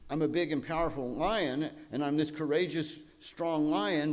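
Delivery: lightly hammed-up lion bragging; false bravado. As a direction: puff yourself up like a cowardly lion faking courage. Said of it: "whose false bravado tries to tell them".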